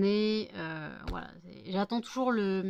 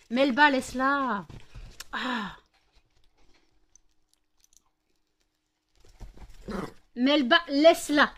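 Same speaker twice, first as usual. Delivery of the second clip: slow, loud, higher order to the dog; firm command; 4 semitones higher, 7 dB louder, slower than usual. Real, not acted.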